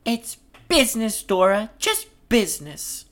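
high-pitched brush-off; high stage voice, dismissing a friend; [name]'s character voice. Acted.

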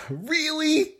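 high, disbelieving really; can't believe he did that; incredulous. Real, not acted. Disbelief at Gary's choice, high incredulous.

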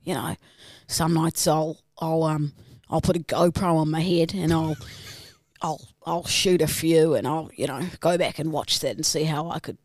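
low, subdued Aussie confession; dark, low-pitched Australian monologue; darker Australian character. Acted. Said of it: "I want it a bit darker".

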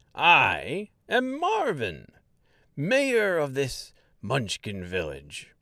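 slow, high, grandly welcoming; a proud little mayor making a speech; jolly Munchkin mayor. Acted. Mayor formally welcomes Dorothy and Mister, slow proud.

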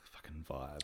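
whispered, exasperated grumble; muttered curse. Retold or imitated. Annoyed moviegoer to himself about noisy newcomers, muttered. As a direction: grumble it under your breath, barely voiced.

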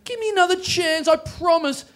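high-pitched pleading whine. Acted. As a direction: beg the judges for another chance. Comic begging the judges.

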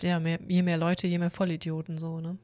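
ordinary delivery

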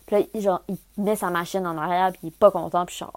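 ordinary talk

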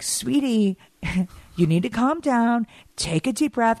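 high, condescending imitated calm; sarcastic fake calm; mock soothing. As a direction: patronize an angry sister sweetly.

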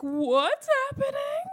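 high, drawn-out alarmed question; panicked cry. Retold or imitated. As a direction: shriek it in disbelief. Water breaks, panicked question.

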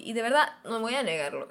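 normal talking voice

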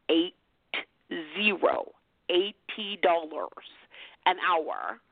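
slow, deliberate, pauses between "eight", "zero" and "eighty dollars"; really slow. Shown as a demonstration. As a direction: stretch out "eighty dollars", pause, then "an hour". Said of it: "say that again really slow".

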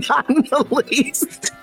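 slow, high amused denial; laughing. Real, not acted. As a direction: laugh while saying no. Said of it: "was that convincing?".

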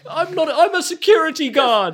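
high, loud whiny protest; defensive guard. Acted. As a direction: protest like a sulky teenager.

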